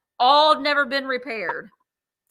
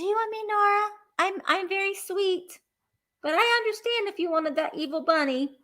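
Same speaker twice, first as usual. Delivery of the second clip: a higher, lightly voiced angel pleading sweetly; 7 semitones higher than usual. June angel figurine coaxing the bidder Nora, a higher sweet plea. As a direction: let the angel plead her own case.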